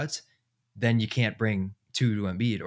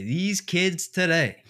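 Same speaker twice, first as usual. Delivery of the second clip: put-on slow, higher, drawn-out grumbly old uncle; my uncle's saying; 8 semitones higher, 3 dB louder, much slower than usual. Quoting his uncle about kids today, slow mock grumble. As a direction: stretch it out like a fed-up old relative. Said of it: "you know what my uncle would say".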